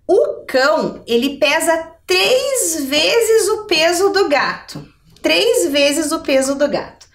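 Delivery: normal talking voice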